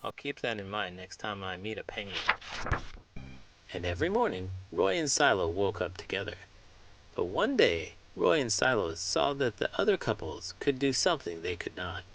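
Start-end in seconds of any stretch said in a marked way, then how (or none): none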